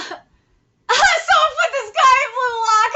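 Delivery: high, loud, cracking-up shout; laughing outburst. Real, not acted. Reader reacting to the soccer scene, shouting with laughter. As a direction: yell it through laughter.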